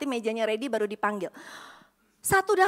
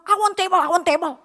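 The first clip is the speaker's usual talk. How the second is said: loud, higher broken-English demand; 7 semitones higher, 10 dB louder than usual. Retold or imitated. Japanese guest demands a table, loud broken English. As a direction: bark the broken phrase twice. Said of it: "ngomongnya patah-patah".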